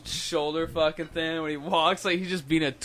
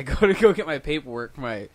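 ordinary talk

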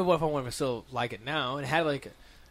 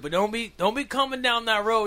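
put-on higher, louder old man warning; old neighbor warning kids off his road, loud; cranky old neighbor; 9 semitones higher, 6 dB louder than usual. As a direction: warn the kids off like a grumpy neighbor. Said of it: "He's like".

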